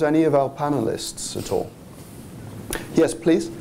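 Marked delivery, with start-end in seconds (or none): none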